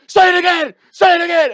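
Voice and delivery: very high, loud imitated enraged scream; hammed-up screeching fury; losing it. Guy being thrown out of the bar, losing it. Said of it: "He loses it".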